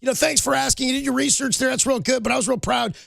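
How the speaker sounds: high, sing-song boastful coach; Sean McVay impression. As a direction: brag like a proud coach showing off.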